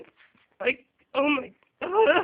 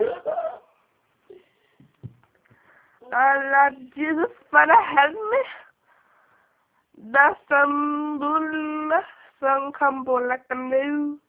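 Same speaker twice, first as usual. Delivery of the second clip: Honey's voice; much slower than usual. Retold or imitated. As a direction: wail to the Lord like Honey. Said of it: "start talking like Honey".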